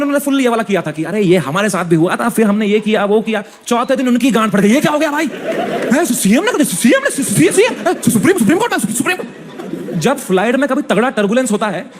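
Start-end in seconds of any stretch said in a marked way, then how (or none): 4.7-10.0 high, laughing, stuttering panic; mock panicked stammer. Panicked friend stammering quote. (घबराहट में हकलाते हुए, हँसी के साथ)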